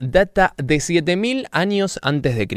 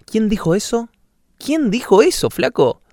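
high, scared asking; alarmed, who's there; frightened question; 7 semitones higher than usual. Acted. Man startled by voice.

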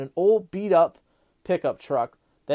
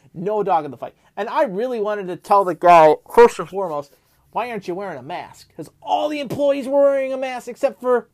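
higher and louder, building outrage; ranting; 6 semitones higher, 4 dB louder than usual. Real, not acted. Vlogger ranting to viewers about a rude customer, heated. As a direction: let the disbelief boil over into a rant. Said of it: "time for [name] Rants".

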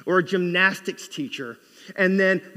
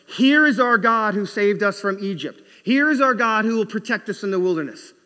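loud, raised cry of a crowd hailing its idol; mock proclamation; 4 semitones higher, 6 dB louder than usual. Israelites acclaiming the golden calf.

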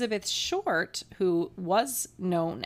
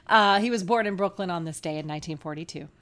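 usual speaking voice

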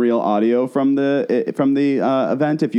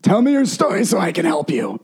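higher, declaimed mock plea; imitated celebrity voice; 5 semitones higher than usual. Host imitating the star addressing a refugee family, put-on declaiming voice. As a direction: proclaim it like a self-appointed rescuer. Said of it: "She goes".

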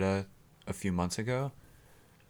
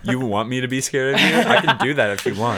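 laughing; 5 semitones higher, 7 dB louder than usual. Real, not acted. Jokes at the grasshopper.

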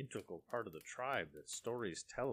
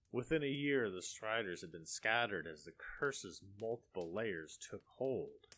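usual speaking voice

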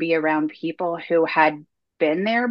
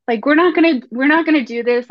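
louder, higher retold protest; insistent refusal; 9 semitones higher, 7 dB louder, faster than usual. Speaker arguing with herself before the pandemic, insistent refusal. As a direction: dig in and insist, voice raised.

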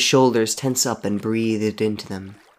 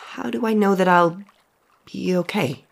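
higher, worried voice; nervous question; 10 semitones higher than usual. Acted. Henry asking the dead boy, mildly frightened. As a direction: ask it unsure and scared.

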